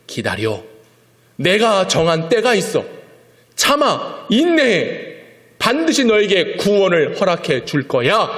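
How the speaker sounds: firm loving promise of salvation; God answering. Acted. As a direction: urge the prophet to endure. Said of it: "하나님은 하박국을 향하여".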